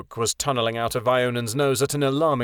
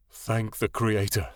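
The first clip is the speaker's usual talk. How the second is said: softer, slow, lower with relief; deep voice wavering; 3 semitones lower, 4 dB quieter, slower than usual. A narrator's reading. Father relieved to find his daughter, voice wavering. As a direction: let the relief shake the words. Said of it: "his deep voice wavering".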